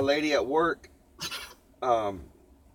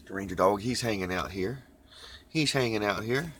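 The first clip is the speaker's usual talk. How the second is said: normal talking voice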